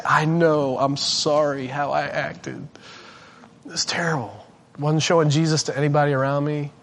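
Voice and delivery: low, soft, contrite confession; humble apology. Retold or imitated. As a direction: apologise quietly, owning up to it. Imagined humble apology to wife.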